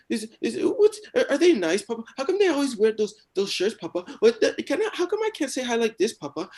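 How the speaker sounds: put-on very high, loud toddler voice; pestering little kid. Hiding toddler pestering papa with questions, high and loud. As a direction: stammer out question after question, squeaky and loud. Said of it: "there's just a fucking little kid".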